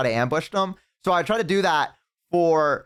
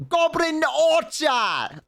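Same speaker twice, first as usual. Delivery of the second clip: slow, much higher, drawn-out shout; hyped call-out; 12 semitones higher, much slower than usual. Real, not acted. Streamer shouts a unit name to chat, hyped and drawn out. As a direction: belt the name out like an announcer.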